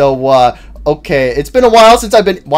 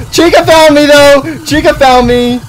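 higher, louder startled panic; alarmed cry; 9 semitones higher, 5 dB louder than usual. Real, not acted. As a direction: blurt it out in alarm. Spotted by Chica.

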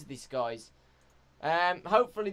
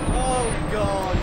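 alarmed; 5 semitones higher, 10 dB louder than usual. Real, not acted. Frightened by VR horror game.

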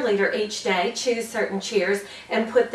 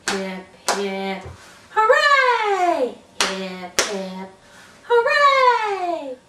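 hip hip hooray; 6 semitones higher, much slower than usual. Shown as a demonstration. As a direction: build to a burst on hooray.

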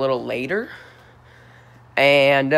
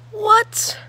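much higher, a squeaked "What?"; shocked. Real, not acted. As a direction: yelp it out like you can't believe it. Shocked by the stat.

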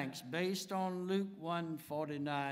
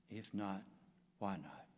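ordinary talk

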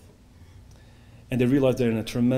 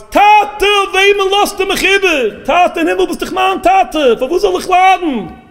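very high, loud screamed plea; screaming and shrieking; 13 dB louder than usual. Acted. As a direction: cry out to heaven at full voice. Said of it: "screams and shrieks and shouts".

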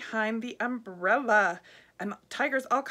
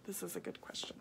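soft unvoiced whisper; whispered aside; 4 semitones lower, 15 dB quieter than usual. Real, not acted. Reader whispering to the children, hushed. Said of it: "whispered aside".

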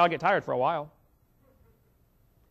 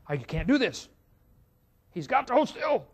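nervous dentist; 4 semitones higher than usual. Retold or imitated. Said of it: "the dentist was getting all nervous".

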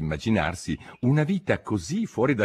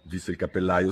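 ordinary delivery, nothing marked

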